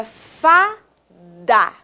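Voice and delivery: "fada" split into two separate syllables; teacher slowly separating "fada". Shown as a demonstration. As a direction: pause between the halves of "fada". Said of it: "Falei a minha palavra pausadamente".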